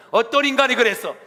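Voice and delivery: loud, high enraged demand; furious mother. Acted. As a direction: blow your lid at the school. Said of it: "뚜껑 열려가지고". (뚜껑 열린 엄마의 분노)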